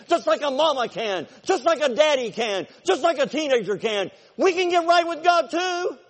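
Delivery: loud, high impassioned preaching; the preacher pounds it home, loud and high; full-force preaching. Acted. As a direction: preach it hard to the back row.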